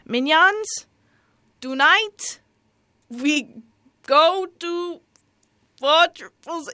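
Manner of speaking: high, loud Gru accent with big pauses; Gru impression. Shown as a demonstration. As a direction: announce it haltingly to your henchmen as Gru. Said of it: "I should do the accent".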